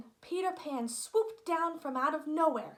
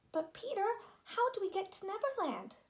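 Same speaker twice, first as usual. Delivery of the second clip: put-on lifted, higher girlish voice; Wendy's curious, higher-pitched plea; young girl asking; 8 semitones higher than usual. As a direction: ask like an eager young girl.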